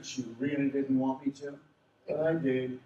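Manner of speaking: ordinary delivery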